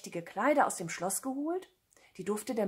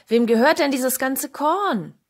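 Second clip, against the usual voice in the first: loud, raised questioning call; 5 semitones higher, 9 dB louder than usual. A narrator's reading. Cat asks who owns the corn, calling out. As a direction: shout the question across the field. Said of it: "rief er".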